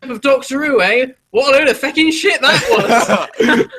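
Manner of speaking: hammed-up dad scoffing. Dad at the washing up damning the episode, loud laughing mock voice. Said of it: "he went".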